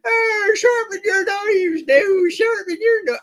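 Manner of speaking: West Country street crier, shouted high and slow; West Country accent. Pretend. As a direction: call out like a West Country knife grinder. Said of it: "you've got a West Country accent all of a sudden".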